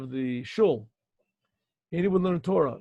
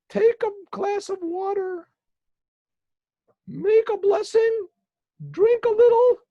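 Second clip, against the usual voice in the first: fake angel voice; 5 dB louder than usual. Boy as angel gives instructions.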